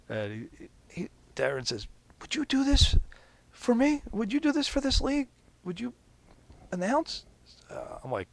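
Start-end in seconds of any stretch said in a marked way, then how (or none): 2.2-7.3 eager request. Retold or imitated. Darren asks him to announce.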